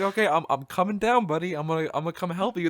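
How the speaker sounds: high-pitched calling to a teammate; retold rescue call. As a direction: call out that help is coming, playfully. Acted reassurance to teammate.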